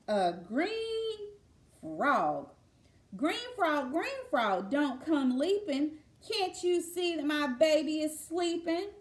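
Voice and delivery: lilting read-aloud refrain; sing-song lullaby reading. Acted. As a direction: chant the refrain like a lullaby rhyme.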